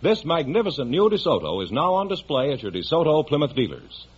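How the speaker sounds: ordinary talk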